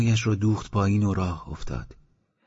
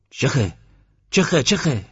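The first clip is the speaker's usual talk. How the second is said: a shooing call that climbs higher with each repeat; shooing a dog; 5 semitones higher, slower than usual. Acted. Abbas shoos the old dog toward Khatunabad, rising call. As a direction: wave the old dog off, getting more insistent.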